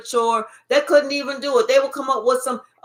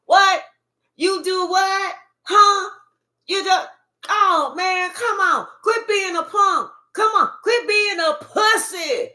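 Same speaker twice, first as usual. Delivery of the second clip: higher, louder taunting; mocking friends; 6 semitones higher, 3 dB louder than usual. Friends teasing the crying one, high loud mocking taunts. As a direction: jeer at them like a teasing buddy. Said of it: "their friends is like".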